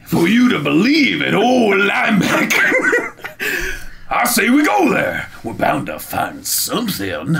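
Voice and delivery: high, slow shouted sailor voice; character voice. Acted. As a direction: boom it like a boastful captain. Voices Linebeck character reading.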